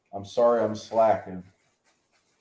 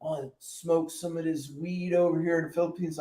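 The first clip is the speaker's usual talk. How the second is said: mock stoner; 4 semitones higher, 6 dB quieter than usual. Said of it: "Somebody be like".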